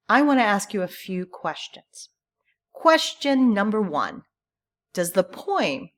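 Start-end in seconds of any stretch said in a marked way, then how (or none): none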